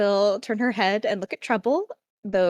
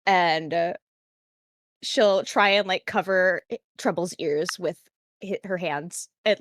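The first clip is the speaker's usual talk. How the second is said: ordinary talk, nothing marked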